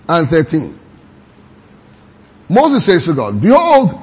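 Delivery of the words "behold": exclaiming Behold. Retold or imitated. Moses addressing God, quoted.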